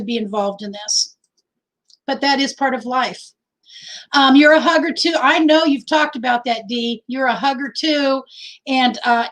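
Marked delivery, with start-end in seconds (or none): none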